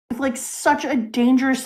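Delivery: usual speaking voice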